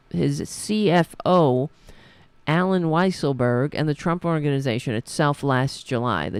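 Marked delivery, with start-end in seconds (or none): none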